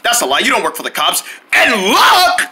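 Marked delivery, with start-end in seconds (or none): 1.5-2.4 calling out. Acted. Calling out to brother.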